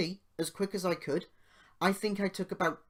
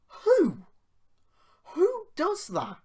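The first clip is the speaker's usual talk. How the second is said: much higher and louder, slow "who does that?"; outraged disbelief; 13 semitones higher, 8 dB louder, slower than usual. Real, not acted. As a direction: demand an answer nobody can give. Outraged rhetorical question.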